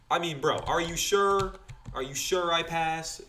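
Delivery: slow, incredulous; disbelieving. Retold or imitated. Student doubting the examiner in slow disbelief. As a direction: draw it out in disbelief. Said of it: "I was thinking".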